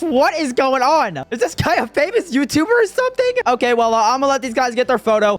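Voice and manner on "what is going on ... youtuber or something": high, incredulous question; incredulous surprise. Real, not acted. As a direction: ask it in rising disbelief. Stunned by a fan photo request.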